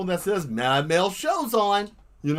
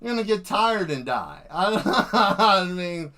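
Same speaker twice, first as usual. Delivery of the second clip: words spilled through laughter; laughing; 3 semitones higher, slower than usual. Real, not acted. Telling runners they will die, laughing. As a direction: chuckle the line out.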